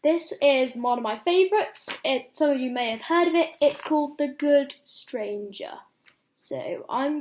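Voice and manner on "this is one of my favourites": ordinary delivery, nothing marked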